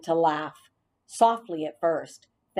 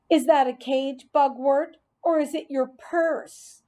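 taunting bully; 8 semitones higher than usual. Acted. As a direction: jeer at the kid like a schoolyard bully. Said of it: "Bean asked".

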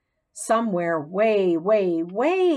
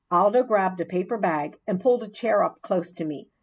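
ordinary delivery

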